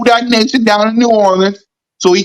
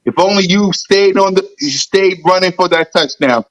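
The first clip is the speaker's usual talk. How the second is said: ordinary delivery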